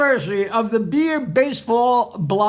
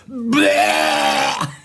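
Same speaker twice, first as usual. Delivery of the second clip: loud, low vomiting noise; mock retching; 14 semitones lower, 3 dB louder than usual. The cab passenger answers the driver, mock retching. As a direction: heave out a long, low comic retch. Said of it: "The guy goes".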